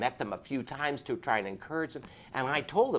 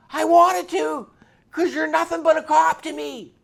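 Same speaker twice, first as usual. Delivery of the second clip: much higher and much louder, a biker's fury at cops; angry shout; 15 dB louder than usual. Retold or imitated. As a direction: shout it out, full force. Biker's anger peaks in quote.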